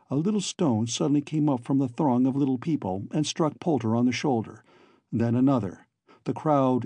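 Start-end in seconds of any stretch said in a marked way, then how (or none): none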